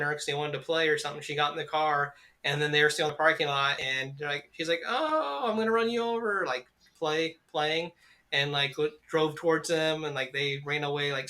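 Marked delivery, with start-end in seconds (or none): 4.9-6.4 playful mock threat. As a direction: imitate a mother playing a chasing game. Voices mother playfully threatening her kids.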